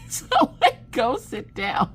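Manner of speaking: high, slow squeak through laughing; real helpless giggling whine; tearful laughter. Real, not acted. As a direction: squeeze the words out while cracking up. Laughing too hard to talk.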